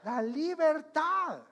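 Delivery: loud, high, slowly drawn-out answer; emphatic proclamation. Real, not acted. Preacher answering his own question for the congregation, emphatic proclamation.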